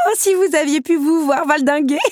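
high, gleeful exclamation breaking into laughter; uncontrollable giggles. A narrator's reading. Belle recalling the beast's tumble, giggling helplessly. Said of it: "Le fou rire la reprit".

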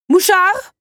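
very high, loud summoning shriek; full-throated yell. Acted. Countess summoning her servant, screaming his name. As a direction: yell for the servant at the top of your voice. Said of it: "s'égosilla la comtesse".

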